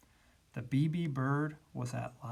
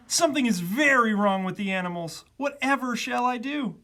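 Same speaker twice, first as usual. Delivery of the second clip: raised, flustered zookeeper; higher, worried outcry; alarmed shout; 8 semitones higher, 6 dB louder than usual. A narrator's reading. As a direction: exclaim in mounting distress. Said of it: "he shouted".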